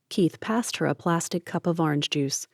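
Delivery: ordinary delivery, nothing marked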